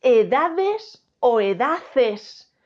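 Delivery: "Edades" y "edaces" muy lentos, más fuertes; contrapón "Edades" y "edaces", alargando cada sílaba; comparación didáctica. Shown as a demonstration. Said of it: "¿yo qué digo en plural?". ("Edades" frente a "edaces", con volumen y muy despacio)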